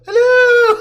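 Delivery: long, loud, high-pitched hello; big Seinfeld holler. Acted. Courtney imitating a friend's greeting up the slope, big playful holler. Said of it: "she hollered up a big Seinfeld".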